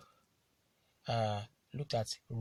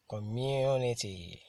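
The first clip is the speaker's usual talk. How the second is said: "community" stretched out, with a long, strong MU; slow coMMUnity, falling away after the stressed MU; 3 dB louder, slower than usual. Shown as a demonstration. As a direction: model "community" slowly, leaning hard on the second syllable MU. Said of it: "I T Y are usually stressed".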